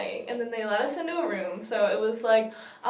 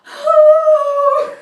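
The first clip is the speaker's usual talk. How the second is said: very high, loud, held squeal; awestruck squeal; 16 dB louder than usual. Retold or imitated. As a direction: let out a long thrilled shriek. Sees TARDIS, gasps.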